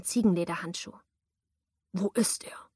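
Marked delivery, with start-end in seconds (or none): 1.9-2.7 whispered menace from the attacker; menacing whisper. A narrator's reading. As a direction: hiss the demand close to her ear. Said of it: "verlangte der Mann bedrohlich".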